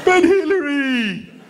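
high, slow whine; whining Clinton. Acted. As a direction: whine it like a caught husband. Bill's whining reply.

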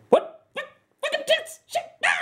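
very high, strained squeal; hyper-anxious panic. Acted. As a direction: lose it completely. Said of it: "I was in a state of hyper-anxiety".

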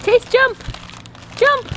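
high, loud cheering; excited cheer. Real, not acted. Cheering a child on trampoline.

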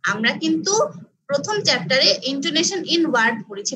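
ordinary talk, nothing marked

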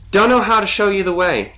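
high, loud echoing voice. A narrator's reading. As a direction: repeat it back high and loud. Echo repeats Connor's words back.

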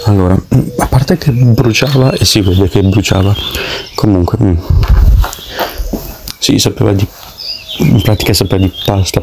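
chewing while talking; speaking between bites of pasta; mouth full. Real, not acted.

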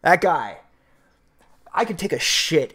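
ordinary talk, nothing marked

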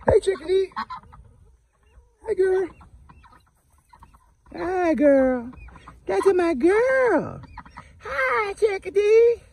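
very high, cooing pet talk; very high doting voice to a pet bird. Real, not acted. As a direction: coo at the little bird. Baby-talking to a pet bird.

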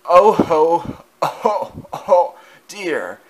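high, strained wheezy groans; feeble coughing Henry. A narrator's reading. Henry pretending to be broken on the turntable. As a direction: fake a pitiful breakdown. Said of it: "Henry coughed feebly".

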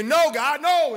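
high, loud protesting no, no; mock pleading cry. Believer refusing God's correction, loud pleading. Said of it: "We say".

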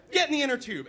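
high, loud command; freaking-out dad. Retold or imitated. Dad ordering mom into inner tube, panicked shout. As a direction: yell the order at your wife. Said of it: "my dad's freaking".

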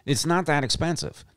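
usual speaking voice

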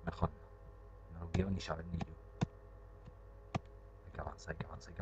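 hushed, breathless whisper to herself; scared whisper. Real, not acted. Gamer dreading the next scare, whispering to herself. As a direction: whisper it like you dread the answer.

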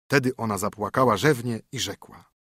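ordinary delivery, nothing marked

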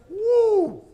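loud, very high "woo"; excited whoop. Acted. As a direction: whoop at the power of thunder. Reacts to last night's thunder.

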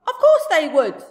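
the giant's loud insistent shout. Acted. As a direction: shout it with total certainty. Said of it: "the giant shouted".

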